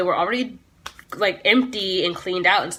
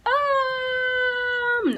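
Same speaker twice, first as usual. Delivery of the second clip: mock hesitant um; 13 semitones higher, 4 dB quieter than usual. Hesitant answer to police.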